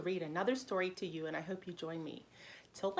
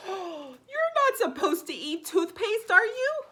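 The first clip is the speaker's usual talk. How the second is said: mock shock; 9 semitones higher, 8 dB louder, slower than usual. Dog sees boy eat toothpaste.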